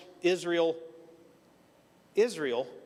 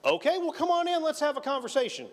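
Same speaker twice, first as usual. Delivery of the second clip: high, bright-eyed church greeter voice; mock welcoming minister; 12 semitones higher than usual. Minister welcoming the student in, high mock greeting. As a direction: play the surprised minister inviting him in.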